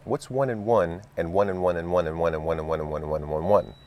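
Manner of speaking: loud, fast rattle of ones; rapid quiz. Acted.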